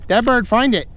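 high, loud calls to the dog; loud dog command. Real, not acted. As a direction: urge the dog on loudly.

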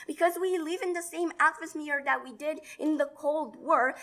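usual speaking voice

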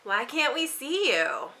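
usual speaking voice

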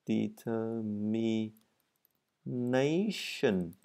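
Determination drawn out very slowly, every syllable clear; careful pronunciation. Shown as a demonstration. Teacher slowly models Determination for learners. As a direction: spell out Determination syllable by syllable, very slow. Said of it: "các bạn hay đọc thiếu cho âm".

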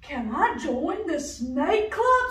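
high, loud eager duck. Acted. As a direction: ask brightly like a duck. Duck asks snake.

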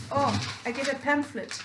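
normal talking voice

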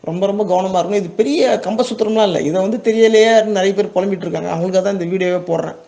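usual speaking voice

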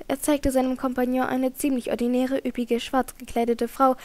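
ordinary delivery

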